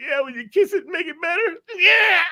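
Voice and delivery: very high, strained squeal; hammed-up agony, squeezed falsetto reply; mock pained plea. Injured man answers the trooper.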